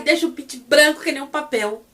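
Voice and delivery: usual speaking voice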